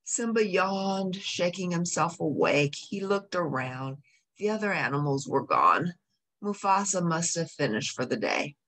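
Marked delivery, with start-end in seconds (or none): none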